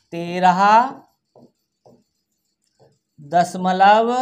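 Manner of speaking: word by word with long pauses; dictation. Shown as a demonstration. Teacher reading out decimal digits to children, slow dictation. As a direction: stretch each digit and wait while it is written.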